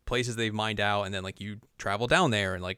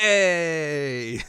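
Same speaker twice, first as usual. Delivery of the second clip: long, higher, louder mock hooray; crowd cheer; 6 semitones higher, 6 dB louder than usual. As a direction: stretch out a celebrating cheer. Acting the crowd cheering again.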